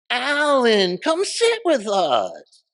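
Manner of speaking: high, loud, slow sugary call; sweet hostess invitation. Retold or imitated. Club owner invites the comic to sit during a bomb scare, sugary high call. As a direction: lure him over sweetly, drawn out. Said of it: "this is how funny she could be".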